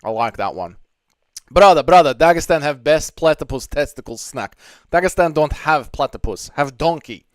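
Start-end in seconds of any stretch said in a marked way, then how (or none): 1.5-7.2 loud, hammed-up Dagestani accent, broken English; mock Dagestani accent. Streamer answering chat in a loud mock Dagestani accent. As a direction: call out brother, brother in a Dagestani accent.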